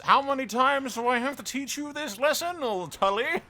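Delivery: high, loud and ranting; worked-up mock telling-off; exasperated mock scolding. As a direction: scold an old foe in high-pitched exasperation. Mock scolding a rebellious house.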